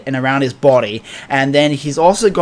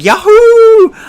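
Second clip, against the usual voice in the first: high, loud excited cartoon-hero yell; Luffy's whoop; 8 dB louder than usual. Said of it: "and he's like".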